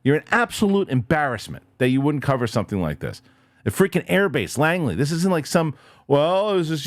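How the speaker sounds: ordinary delivery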